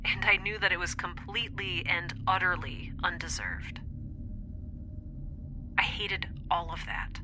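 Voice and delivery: whispered. Acted. Confessing shameful feelings alone.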